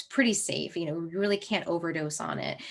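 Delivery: ordinary talk, nothing marked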